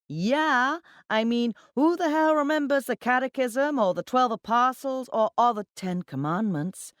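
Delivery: loud, high scornful rant; talking too loudly. A narrator's reading. Account manager mocking religion to colleagues, talking too loudly. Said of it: "talking too loudly".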